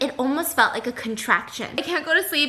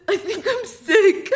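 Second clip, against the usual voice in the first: imitating sick fiance; 6 semitones higher, slower than usual. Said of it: "he goes".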